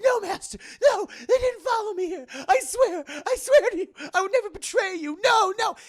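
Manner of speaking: high, loud, wailing denials; hammed-up desperate pleading. Renfield denying he betrayed Dracula, frantic mock wailing. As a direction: beg the master to believe you.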